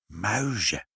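hushed, lightly nervous confession; a touch of furtive dread; skittish whisper. A narrator's reading. Inspector confesses his trouble to the parents, skittishly hushed. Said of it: "fluisterde meneer Pinkepank schichtig".

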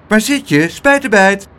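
high, loud searching call. A narrator's reading. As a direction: call for a missing friend. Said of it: "riep Pluk".

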